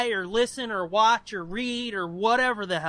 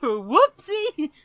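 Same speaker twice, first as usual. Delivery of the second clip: mock oops; 6 semitones higher, 5 dB louder than usual. Admitting forgotten URL.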